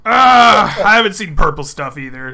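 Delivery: loud, rambling, fast orc barkeep; an out-of-his-depth orc blustering through; flustered orc barman. Acted.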